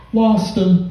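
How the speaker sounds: high, proud little whisper of triumph; smug driver. Retold or imitated. Drunk driver to friend after the headlights trick, smug. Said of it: "looked at me, was like".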